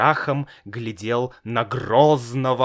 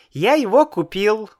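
put-on high little boy's voice, much higher; child voice; 10 semitones higher, 4 dB louder than usual. A narrator's reading. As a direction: play a small boy, innocent. Child answers father.

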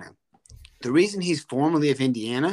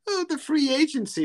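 jumps much higher on Oh, then falls in a whine; a pearl-clutching complainer; mock whiner; 13 semitones higher than usual. Host mocking fans who cry about transfers, whiny high mock voice.

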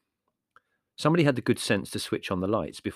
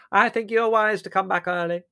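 high, loud, placid aunt voice; dotty aunt; 12 semitones higher, 8 dB louder than usual. A narrator's reading. Aunt Fan approving their early return, dotty aunt. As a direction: prattle on high and obliviously.

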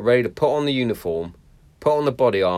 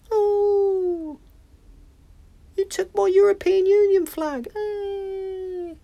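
very high whiny mock voice; whiny snowflake; much slower than usual. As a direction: mimic a whining snowflake. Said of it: "stop being so soft and so sensitive".

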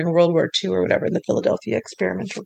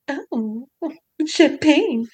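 giggly swoon; 5 semitones higher, much slower than usual. Retold or imitated. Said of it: "she's all like".

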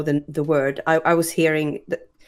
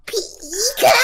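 very high squeaky Pikachu cry; 3 dB louder than usual. Pretend. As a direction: squeal it like the cartoon mouse. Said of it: "please do Pikachu".

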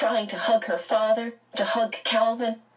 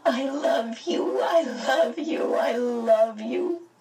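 high, slow sobbing repeats; child sobbing with love; 5 semitones higher, slower than usual. A narrator's reading. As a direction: weep the words over and over. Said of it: "her sobs almost as loud".

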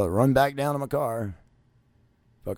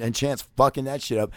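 ordinary delivery

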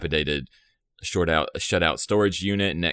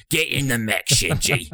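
Gendo dub voice; 7 semitones higher than usual. Retold or imitated. Host doing Gendo's dub voice, ordering Shinji, lightly commanding. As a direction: bark the order like a stern anime father, a little higher. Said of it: "This is what Gendo sounds like in the dub".

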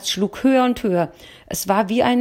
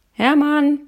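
calling Hermann loudly from another room; 8 semitones higher, 6 dB louder than usual. A narrator's reading. Wife calls out to her husband, loudly. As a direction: raise the voice to call him. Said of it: "rief ich".

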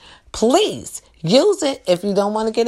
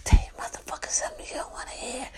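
rapid whispered aside; whispered prompt; 6 semitones higher, 8 dB quieter, faster than usual. Pretend. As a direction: whisper it fast, like secret coaching in the ear. Whispers advice in listener's ear.

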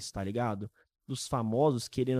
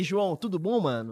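ordinary talk

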